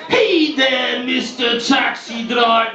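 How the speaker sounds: lightly voiced warden, slowed drawn greeting; mock the warden's slow hail; shit impression of traffic warden. Cabbie mocking a traffic warden, slow mock greeting. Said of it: "the same shit impressions".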